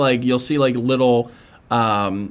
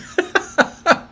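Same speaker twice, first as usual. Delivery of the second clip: real laugh. Real, not acted. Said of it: "That's pretty funny".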